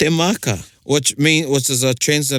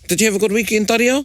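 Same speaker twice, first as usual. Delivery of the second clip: friendly question; 9 semitones higher, faster than usual. Shown as a demonstration. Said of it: "So I might say to Tariao".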